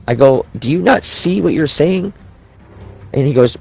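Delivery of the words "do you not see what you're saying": slow, high-pitched disbelief; incredulous. Retold or imitated. Telling his friend off over more babies, incredulous. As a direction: stretch it out in retold exasperation, pitched up.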